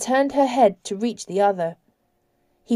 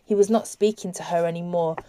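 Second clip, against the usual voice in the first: plain reading voice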